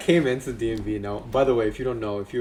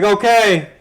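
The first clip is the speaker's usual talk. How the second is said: loud, raised mock shout; one-word mock yell; imitated yelling; 8 semitones higher, 8 dB louder than usual. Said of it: "he's just yelling this whole time".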